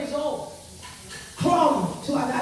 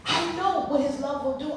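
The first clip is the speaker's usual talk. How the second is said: usual speaking voice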